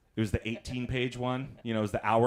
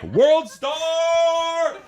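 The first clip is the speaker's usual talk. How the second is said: loud excited yell of World Star; shout; 14 dB louder than usual. Retold or imitated. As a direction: belt it out like a hype man. Son shouting at sister fight.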